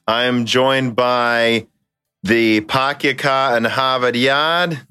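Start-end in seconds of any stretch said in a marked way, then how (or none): 2.2-4.8 put-on slow, broad Boston accent, no r's; teasing Boston accent, drawn out; mock Boston accent. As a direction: drop the r's like a Bostonian park-the-car joke. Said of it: "I do speak with a heavy, thick Boston accent".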